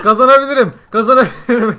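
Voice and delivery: loud, high elated yell; excited shouting. Real, not acted. Player after a kill tells friend, excited shouting. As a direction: shout as victory comes within reach.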